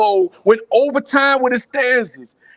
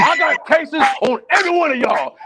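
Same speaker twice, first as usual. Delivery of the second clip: hammed-up Training Day Denzel boast; Denzel tough guy; 7 semitones higher than usual. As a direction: bark it like a crooked cop boasting. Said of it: "I swear you was channeling Training Day, Denzel Washington".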